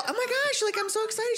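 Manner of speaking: high gushing excitement with a laugh; retold excitement. Telling coworker she'll come to church, high excited gush. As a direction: gush like you just got great news.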